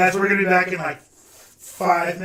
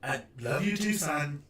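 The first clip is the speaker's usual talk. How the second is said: soft; 2 semitones lower, 9 dB quieter, slower than usual. Acted. Mom character answers softly.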